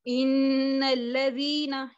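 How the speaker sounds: इन्नल्लज़ीना recited slowly, hamza of अल्लज़ीना dropped into the nun; slow recitation, nun flowing into लज़ीना; joined hamza. Shown as a demonstration. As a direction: slur इन्नल्लज़ीना together, no glottal stop. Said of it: "ऐसे पढ़ा".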